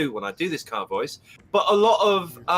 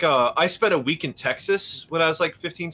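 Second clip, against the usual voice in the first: usual speaking voice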